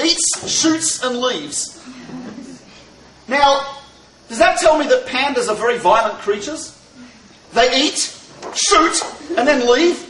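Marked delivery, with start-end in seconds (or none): 0.0-1.8 slow book title. Shown as a demonstration. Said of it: "It's called". 7.5-10.0 eats, shoot, leave spaced slowly with pauses; comma reading. Shown as a demonstration. Teacher reading with commas to the class, slow and spaced. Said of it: "if you put a comma in".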